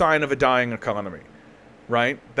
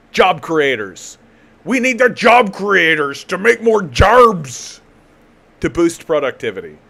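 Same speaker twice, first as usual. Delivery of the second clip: loud, slow, high mocking pitch; sarcastic slogan; 4 semitones higher, 6 dB louder, slower than usual. Mocking bank officials to viewers, loud slow sarcastic boom. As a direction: ham up the job creators line with scorn.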